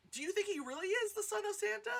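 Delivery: high-pitched disbelieving question; mock-earnest puzzled squeal; incredulous wondering. Retelling own incredulous wondering.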